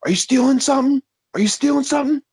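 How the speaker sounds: high, loud mock gas station clerk accusing. Clerk catching a shoplifter, in a high mock squeal. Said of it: "He's like".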